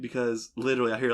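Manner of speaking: ordinary talk, nothing marked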